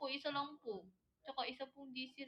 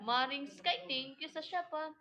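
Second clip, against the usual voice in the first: higher, louder shout-out of thanks; loud thank-you; 3 semitones higher, 9 dB louder than usual. Real, not acted. Streamer thanking Maring Sky for the gift, loud call.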